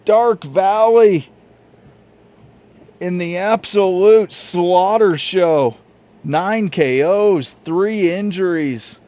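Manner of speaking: loud, high, drawn-out announcer call; big game call. Acted. Announcer calling the final blowout to the audience, loud and high. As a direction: belt the final result like a broadcast climax.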